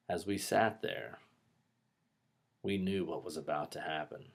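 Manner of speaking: hushed, slow storytelling with long pauses; dramatic pauses. Shown as a demonstration. Teacher showing good pauses, hushed dramatic storytelling. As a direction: drop soft and leave suspense hanging between phrases. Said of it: "good pauses that add dramatic effect".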